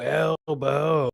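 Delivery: usual speaking voice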